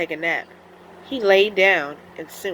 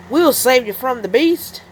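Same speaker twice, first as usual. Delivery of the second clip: louder, higher heroic call; Mister Cat yelling; 5 semitones higher, 7 dB louder than usual. Acted. Mister Cat rushes to help wolf, heroic call. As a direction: call out bravely. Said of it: "yelled Mister Cat".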